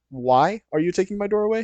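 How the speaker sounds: high, disbelieving question; indignant protest. Retold or imitated. Son protesting to his dad, disbelieving. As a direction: push back in disbelief.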